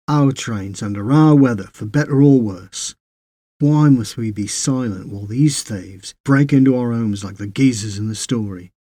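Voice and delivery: usual speaking voice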